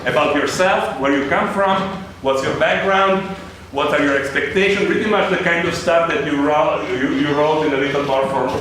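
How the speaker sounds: ordinary talk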